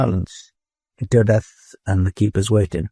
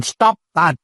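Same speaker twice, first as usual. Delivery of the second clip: much higher sharp snap; snapped; 9 semitones higher than usual. A narrator's reading. Dodath snapping at the looping robot. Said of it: "snapped Dodath".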